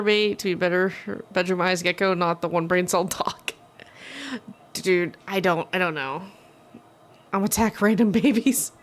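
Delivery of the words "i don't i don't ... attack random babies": ordinary delivery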